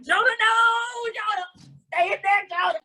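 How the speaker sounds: high, stretched comic cry; mock freak-out. Host imagining Jonah behind the door, mock-panicked cries. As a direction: wail the name in pretend panic. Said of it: "I'm kinda freaked out".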